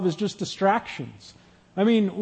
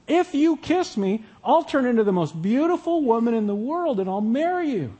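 insistent frog; 5 semitones higher than usual. Acted. Frog repeating its offer to the old man, high insistent voice. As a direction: spell it out for someone who didn't listen. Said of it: "did you hear me?".

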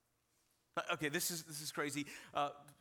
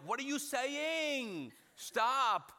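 high, drawn-out mock exasperation; exasperated dad; 7 semitones higher, 4 dB louder, much slower than usual. Dad to crying son he can't understand, exasperated. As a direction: plead over a child's crying, stretched out and high.

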